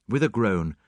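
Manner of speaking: ordinary delivery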